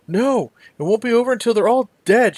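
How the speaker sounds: high, loud, trembling insistence; a terrified daughter refusing comfort; frightened young woman. Acted. Daughter insisting to the rescuer, high and loud.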